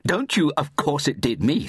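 high, indignant snap; snapping. A narrator's reading. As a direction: snap back at the doubting officer. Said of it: "snapped Mary".

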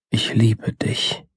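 soft, slow, half-whispered confession; tender near-whisper. Acted. Dreamer recalling a loved one's words in a poem, tender near-whisper. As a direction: breathe the words out slowly and softly.

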